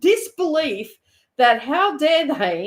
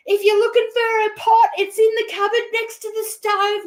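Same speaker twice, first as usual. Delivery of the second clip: high, raised mock yell; 12 semitones higher, 4 dB louder than usual. Ian yelling to police about Dawn's pot, mock high yell. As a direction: call it out for the whole street to hear. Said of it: "he's yelling out".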